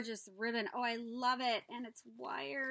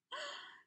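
very high delighted gasp; 4 dB quieter than usual. Real, not acted. Seeing the back of the bag, high gasp.

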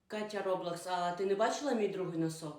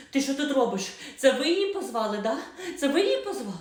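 angry confrontation; 5 semitones higher, 5 dB louder than usual. Acted. Stepmother confronts neighbour.

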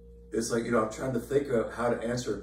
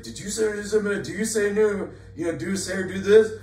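much higher, louder accusing questions; upset brother; 11 semitones higher, 9 dB louder than usual. Retold or imitated. Church brother calling the preacher upset over a report, high and accusing. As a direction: fire the questions like an upset caller. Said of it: "he's upset".

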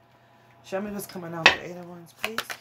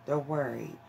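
softer and lower, letting it sink in; quieter, lower repeat; 4 semitones lower, 7 dB quieter than usual. Shown as a demonstration. Echoing the card's message to the viewer, softly and lower. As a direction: drop the voice for the echo.

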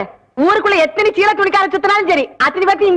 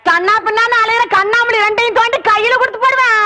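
ordinary talk, nothing marked

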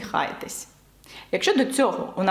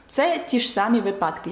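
normal talking voice

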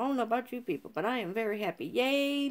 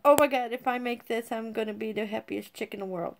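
ordinary talk, nothing marked

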